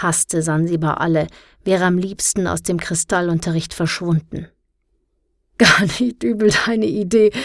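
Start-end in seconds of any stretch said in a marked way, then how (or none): 5.6-7.3 higher, louder, gasping through laughter. A narrator's reading. Teacher laughing at a pupil's idea, gasping. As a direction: wheeze it out amused. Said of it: "japste Doktor Thornham".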